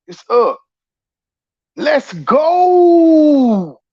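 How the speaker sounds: high, drawn-out rallying whoop; hype yell. Retold or imitated. Voicing her rallying call to KPI, hype yell. Acted hype cry ending a retelling.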